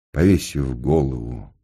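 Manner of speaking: plain reading voice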